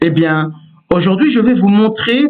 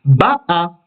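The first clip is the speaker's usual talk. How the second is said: ba'a with the weight on BA; stress on first syllable; 2 semitones lower than usual. Shown as a demonstration. Teacher repeats the past form, ba'a stressed at the start. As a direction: stress the opening syllable of ba'a, let the last drop. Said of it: "c'est le ba qui est accentué".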